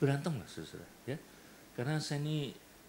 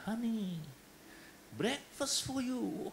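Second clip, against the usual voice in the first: sweet husband; 11 semitones higher, slower than usual. Acted. Husband serves wife breakfast.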